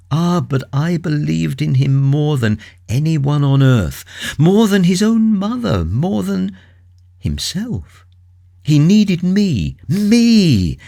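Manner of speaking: high, rising lament of devotion; passionate grief. Acted. Intended's passionate grieving devotion.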